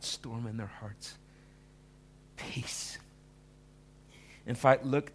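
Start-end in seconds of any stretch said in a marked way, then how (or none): 2.4-3.0 a hushed whisper of the word; whispered peace. Shown as a demonstration. Preacher echoing Jesus to congregation, whispering. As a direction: let it fall as a whisper.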